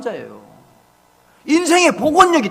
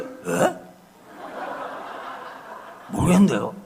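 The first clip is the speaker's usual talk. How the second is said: put-on clueless teen; 5 semitones lower, 9 dB quieter, slower than usual. Teen son's clueless reply.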